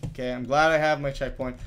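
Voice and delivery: ordinary delivery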